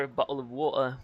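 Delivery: "bottle of water" with the Ts dropped to glottal stops; British glottal T. Shown as a demonstration. Showing the second way, "bottle of water" with dropped Ts. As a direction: swallow both Ts in "bottle of water". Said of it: "you can say it two ways".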